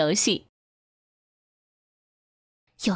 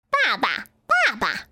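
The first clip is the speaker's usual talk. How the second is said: duckling calling. A narrator's reading. Duckling calls its new father.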